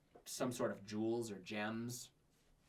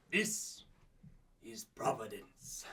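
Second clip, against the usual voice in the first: slow, solemn mentor farewell; slow, measured sage voice; wise old master; 2 semitones lower, 5 dB quieter, slower than usual. Acted. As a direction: send your pupil off gently, with long pauses. Old master sends his pupil off.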